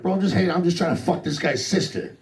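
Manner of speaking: high, eager pleading; innocent protest. Retold or imitated. Comic to the questioning cop, eager innocent protest. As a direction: act innocent and keen.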